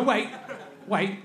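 ordinary talk, nothing marked

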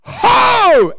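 high, loud burst of "Ho"; sharp loud shout. Retold or imitated. Lady shouting over preacher on the floor, high loud burst. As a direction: blast a single "Ho!". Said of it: "and she went".

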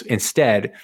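ordinary delivery

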